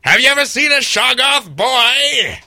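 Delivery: high, loud character holler; hammed-up shout. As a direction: holler it like an over-the-top horror character. Hammed-up character line, then repeated plainly.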